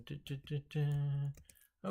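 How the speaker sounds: normal talking voice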